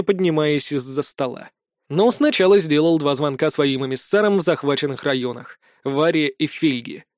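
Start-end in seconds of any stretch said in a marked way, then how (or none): none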